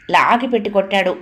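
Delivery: ordinary delivery, nothing marked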